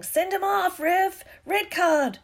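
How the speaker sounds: high-pitched, loud yell; indignant fan's shout. A narrator's reading. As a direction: yell at the referee from the stands. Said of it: "Dean Thomas was yelling".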